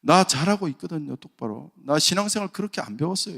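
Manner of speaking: talking back defiantly. Retold or imitated. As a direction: argue back hard at the pastor. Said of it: "이러면서 막 따지는 거죠".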